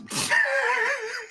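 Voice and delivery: laughing that turns into a wince; a laugh cut short by pain. Real, not acted. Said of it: "it hurts to laugh".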